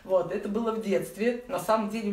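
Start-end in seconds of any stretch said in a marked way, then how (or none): none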